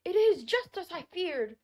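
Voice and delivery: put-on high, loud comic old man cry; a hammed-up fretful old man; old man with a beard. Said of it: "who said".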